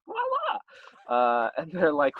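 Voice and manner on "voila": high singsong presentation; mock grand reveal. Hostess unveiling dinner to the couple, mock grand reveal. Woman presents the lit lamp.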